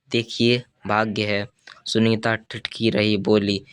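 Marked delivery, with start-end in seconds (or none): none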